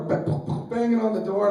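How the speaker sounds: ordinary delivery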